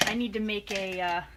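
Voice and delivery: talking around a pen in teeth; marker in mouth. Real, not acted. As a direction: mumble past a marker held in the mouth. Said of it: "I'm holding the marker in my mouth".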